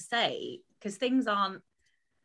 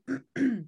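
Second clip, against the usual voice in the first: clearing throat; 7 semitones higher, 5 dB louder than usual. Real, not acted.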